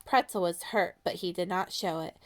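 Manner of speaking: ordinary delivery, nothing marked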